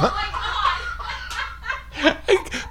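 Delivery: long, high, soft laughing fit; helpless high-pitched giggles. Real, not acted. Cracking up at the wrong guess.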